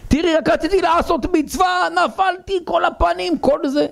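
high, strained shouting husband; the bruised husband cries out to his wife, high and strained; complaining husband. Retold or imitated.

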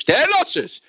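high mock shout; imitated high-pitched gym call; mimicked coach yell. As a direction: pitch it up like a trainer yelling. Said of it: "והחלטתי שצועק עליי".

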